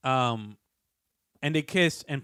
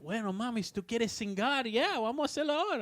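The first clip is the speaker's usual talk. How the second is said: much higher, rushing Spanish come-on; eager Spanish donkey; 9 semitones higher, faster than usual. Retold or imitated. Spanish donkey eagerly answering, high and fast. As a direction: speed up eagerly as he closes the deal. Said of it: "He's like".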